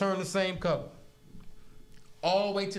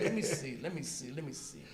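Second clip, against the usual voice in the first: mumbling to herself; 6 semitones lower, 7 dB quieter than usual. Retold or imitated. Officer peering at test results.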